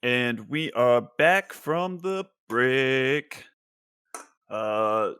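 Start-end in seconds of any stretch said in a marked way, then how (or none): 0.0-3.4 announcer voice. Real, not acted. Host returns from break, announcer style.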